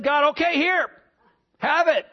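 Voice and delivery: exasperated giving in. Acted. Preacher acting out giving grumblers their quail, exasperated. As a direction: hand it over as if fed up. God giving in to bellyaching people.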